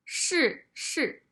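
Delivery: 是是 with two falling tones; both 是 fall sharply; 是是 said shì shì; fourth tones. Shown as a demonstration. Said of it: "The third word".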